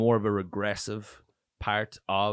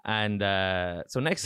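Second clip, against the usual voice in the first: usual speaking voice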